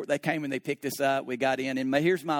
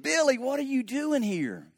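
surprised; 9 semitones higher than usual. Retold or imitated. Surprised at 3:30 am visitor.

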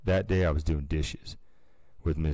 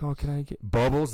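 put-on pleading kid; 8 semitones higher than usual. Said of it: "they'd be like".

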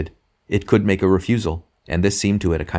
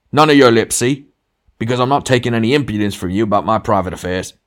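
louder and higher, hardest at the start; angry rebuff; 5 semitones higher, 6 dB louder than usual. A narrator's reading. Ghost guarding his private affairs, angry. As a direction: shut down the impudence with bluster.

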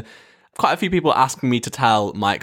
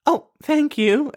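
slow, very high celebrity impression; Tiffany Pollard; slower than usual. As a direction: draw it out in a high diva voice. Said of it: "worst impression of Tiffany Pollard".

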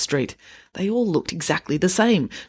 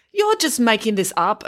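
higher, accusing manager; 6 semitones higher than usual. A narrator's reading. Manager to the children, accusing. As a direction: accuse them of inventing it. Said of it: "accused the manager".

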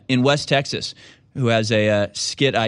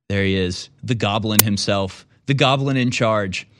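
normal talking voice

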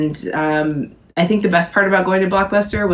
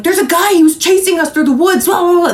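high, panicked retelling; frantic; 12 semitones higher, 4 dB louder, faster than usual. Retold or imitated. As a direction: sound breathless and frantic. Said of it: "they were like".

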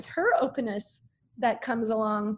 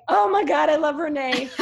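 high, loud burst of delight with a laugh; thrilled recalled thought breaking into a laugh; excited realization; 4 semitones higher, 7 dB louder than usual. Retold or imitated. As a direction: gush like the idea just hit you. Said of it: "I was like".

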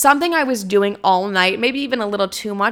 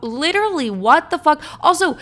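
shocked disbelief; 7 semitones higher than usual. Retold or imitated. Finding out who was texting.

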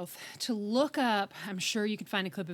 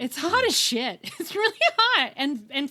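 excited; 5 semitones higher, 7 dB louder than usual. Real, not acted. Gushing, laughing.

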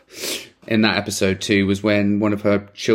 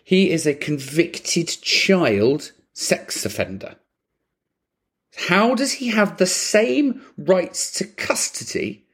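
incredulous outrage; 6 semitones higher than usual. Acted. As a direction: spell it out in exasperated disbelief. Acting out outrage at custody law.

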